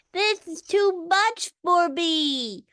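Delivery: put-on child at his limit speaking through nose. A narrator's reading. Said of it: "said through his nose".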